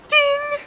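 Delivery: high loud imitated bell ding. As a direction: sing out a sharp notification ding. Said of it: "you know what it is".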